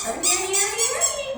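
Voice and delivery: high, insistent calling of the dog's name. Real, not acted. Trainer calling Ernie away from the garbage, high insistent call. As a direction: sing out his name to pull him away.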